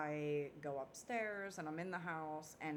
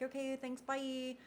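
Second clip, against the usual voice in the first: much higher, singsong sign-off; breezy goodbye; 9 semitones higher than usual. Retold or imitated. Dreamer running past the man, breezy high goodbye. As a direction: toss off a high-pitched retold farewell.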